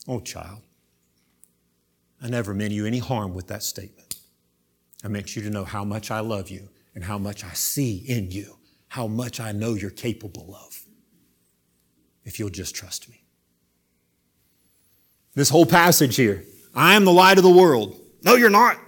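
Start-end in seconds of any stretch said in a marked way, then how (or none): 0.1-13.2 tender reassurance. Acted. Jesus voiced reassuring gently. 16.7-18.0 louder, higher ringing proclamation; bold proclamation. Acted. Jesus proclaims, quoted loudly. 18.2-18.8 much higher, louder scoffing retort; mocking retort. Pharisees' mocking retort voiced.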